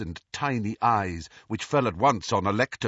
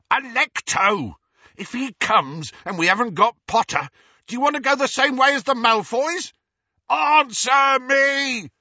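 bellowing; 7 dB louder than usual. A narrator's reading. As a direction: roar at the locked door. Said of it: "Amycus bellowed".

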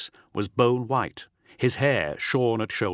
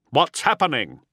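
raised, louder officer's demand; higher, forceful questioning; 5 semitones higher, 5 dB louder than usual. A narrator's reading. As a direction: demand an answer at once. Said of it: "the captain demanded".

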